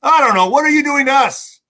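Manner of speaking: loud, high, exasperated demand; indignant outburst. Real, not acted. Ranting at the US officials, loud and exasperated. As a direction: cry out at them in real frustration.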